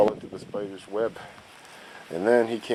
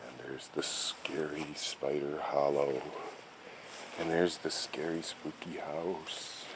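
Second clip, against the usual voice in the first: spooky hush; 4 semitones lower, 13 dB quieter than usual. Acted. Slow spooky listing of dangers.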